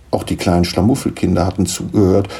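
ordinary delivery, nothing marked

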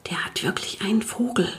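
hushed, whispered aside; soft under-the-breath complaint; quiet. A narrator's reading. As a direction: whisper it so the neighbour can't hear. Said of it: "sagte er leise".

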